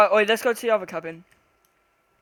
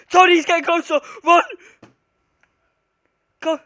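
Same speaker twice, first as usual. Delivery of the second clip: loud, high urgent warning; urgent panic; 6 semitones higher, 10 dB louder than usual. Real, not acted. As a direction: warn your friend to run. Monster approaching.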